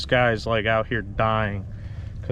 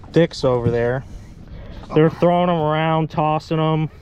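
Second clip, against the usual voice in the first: angry rant; 6 semitones higher, 4 dB louder than usual. Real, not acted. Angry at men who hurt bird.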